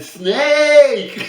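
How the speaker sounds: loud, high yelp of snake through a laugh; laughing shout. Retold or imitated. Caught at the tank, shouting a mock warning to the pet-shop man. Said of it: "I looked at him, I said".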